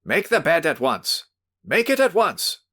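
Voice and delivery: high, loud barked order; menacing shout. Acted. Host shouts orders at cowed visitor. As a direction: order him sharply to make the bed. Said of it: "a second menacing shout".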